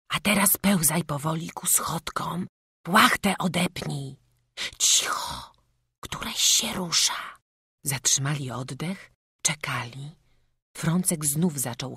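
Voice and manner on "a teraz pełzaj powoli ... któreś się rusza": unvoiced whisper of instructions. A narrator's reading. Mother guiding the boy out of the cart in a whisper. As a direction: whisper so nobody wakes.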